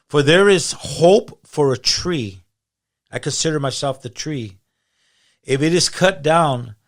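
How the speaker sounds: loud, slow, declaimed Bible verse; emphatic scripture reading. Acted. Guest reading Job to the host, emphatic preaching. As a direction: proclaim it slowly, hitting key words hard.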